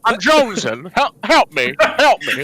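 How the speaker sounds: stammering, desperate addict in character; Jimmy Stewart. Acted. Jimmy confessing a McRib addiction, stammering desperately. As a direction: stutter through a hammed-up confession. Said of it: "I'm Jimmy Stewart and I'm jonesing".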